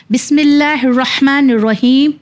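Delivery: slow, measured Quran recitation. Shown as a demonstration. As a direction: intone it like the start of a reading. Opens Quran recitation lesson.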